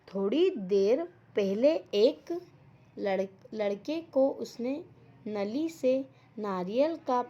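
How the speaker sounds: slow, emphatic reading with punched words; story read-aloud. A narrator's reading. Teacher reading the crow story to children, slow emphatic read-aloud. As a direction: read it out phrase by phrase, pausing between.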